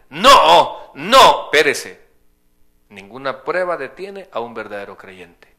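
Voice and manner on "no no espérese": loud sharp no, no, hold on; emphatic shout. Real, not acted. Preacher shouting down the doubters to his congregation, loud. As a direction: cut the objection off with a shout.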